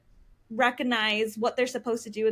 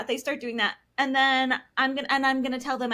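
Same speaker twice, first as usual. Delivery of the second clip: ordinary delivery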